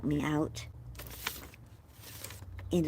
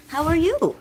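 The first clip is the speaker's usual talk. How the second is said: high, loud cooing question; coaxing pet talk; 11 semitones higher, 5 dB louder than usual. Real, not acted. Owner coaxing pet that likes to talk, high cooing. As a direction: pitch it up sweetly for a pet. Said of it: "if you coax him like that".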